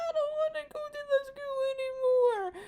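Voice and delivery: put-on high childish whine; Anya whining. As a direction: whine like a scared little girl. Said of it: "She's like".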